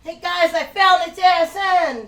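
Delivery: high, loud proud mock announcement; excited discovery. Imitating Val proudly announcing his find, high and loud. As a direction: call it out like a thrilled kid, pitched high. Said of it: "he literally says".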